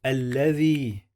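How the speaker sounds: ordinary talk, nothing marked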